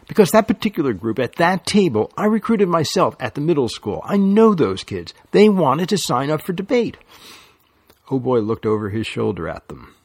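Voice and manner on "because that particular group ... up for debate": higher, louder indignant insistence; heated protest. A narrator's reading. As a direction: fight for your kids. Lily heatedly defends her recruits.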